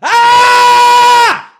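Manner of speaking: long, very loud high scream; full-lung scream. Shown as a demonstration. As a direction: scream at the top of your lungs. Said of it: "at the top of my lungs".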